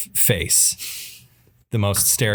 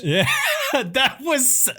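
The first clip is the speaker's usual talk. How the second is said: laughing; much slower than usual. Real, not acted. Laughing at the joke.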